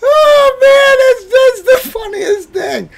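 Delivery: very high wailing speech through tears of laughter; crying with laughter. Real, not acted. Mocking friend's New Year's Eve, crying with laughter. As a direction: talk while laughing so hard it hurts.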